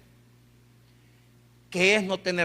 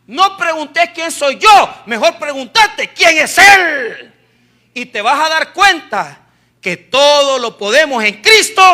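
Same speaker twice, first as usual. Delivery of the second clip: full-force preaching; 7 semitones higher, 12 dB louder than usual. Real, not acted. Preacher urges congregation to ask who He is, fervent shouted preaching.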